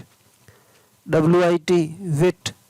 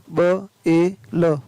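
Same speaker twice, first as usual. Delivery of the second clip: slow, separate phonics sounds; word broken slowly into its sounds; sounding out; much slower than usual. Shown as a demonstration. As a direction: say each sound on its own. Phonics teacher sounding out spelling.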